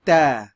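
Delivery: ta with a clean t, no puff of air, said loud; ta said the right way, no breath; unaspirated ta. Shown as a demonstration. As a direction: model ta without aspiration. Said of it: "And one more time".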